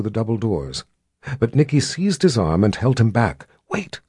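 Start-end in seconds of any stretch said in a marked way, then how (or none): 3.7-4.0 urgent soft whisper. Acted. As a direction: hush him, urgently. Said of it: "she whispered".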